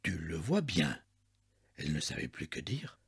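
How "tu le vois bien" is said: low, soft, resigned reply; resigned murmur. A narrator's reading. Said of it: "Il murmura avec résignation".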